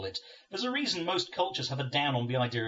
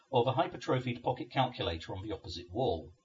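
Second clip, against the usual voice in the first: ordinary delivery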